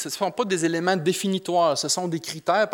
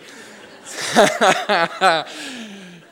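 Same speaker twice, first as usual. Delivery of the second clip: laughing; 4 semitones higher, 6 dB louder than usual. Real, not acted. Laughs at own joke.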